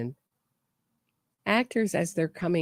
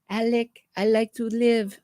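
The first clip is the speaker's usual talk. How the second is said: light put-on Spanish accent; 5 semitones higher, 4 dB louder than usual. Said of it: "I wish I could do a Spanish accent".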